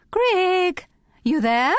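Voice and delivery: high, loud, sing-song call from another room; musical calling voice. A narrator's reading. Housemate calling from outside the kitchen, musical and loud. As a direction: call out brightly for her housemate. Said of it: "called a musical feminine voice".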